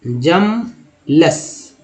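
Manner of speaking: जमलेस drawn out very slowly, each syllable stretched; slow syllable demo. Shown as a demonstration. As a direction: spell out जमलेस slowly for the class. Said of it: "next है जमलेस".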